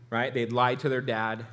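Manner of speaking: ordinary talk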